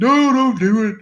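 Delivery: high, loud pleading cry; pleading crowd. Retold or imitated. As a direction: beg them not to, in a high voice. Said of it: "And everybody's like".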